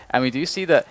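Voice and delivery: rising, excited play-by-play; esports caster. Real, not acted. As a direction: build the call up high and loud. Live play-by-play rises to peak.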